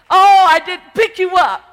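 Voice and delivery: big loud high cry on oh; full preached shout. Acted. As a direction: belt the line out at the peak. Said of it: "the song says".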